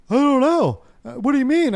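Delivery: put-on high, puzzled voice of the girlfriend; girlfriend's puzzled reply. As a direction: play her as confused and innocent. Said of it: "And she's like".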